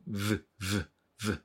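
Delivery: "v" buzzed with lip on upper teeth; soft, separate buzzes on "v"; V sound demo. Shown as a demonstration. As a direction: repeat "v" as a short lip-to-teeth buzz. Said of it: "このvの音ね。".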